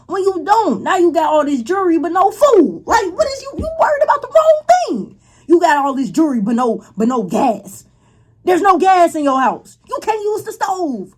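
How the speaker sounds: high-pitched, incredulous rant; exasperated scolding. Real, not acted. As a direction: scold her with mounting disbelief, pitch climbing. Scolding the sister's spending.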